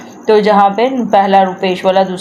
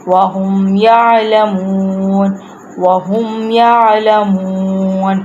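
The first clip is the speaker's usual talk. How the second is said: slow, measured tajweed recitation; Quran recitation; much slower than usual. Shown as a demonstration. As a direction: recite word by word, drawing out the long vowels. Teacher recites verse word by word. (ٹھہر ٹھہر کر تجوید سے تلاوت)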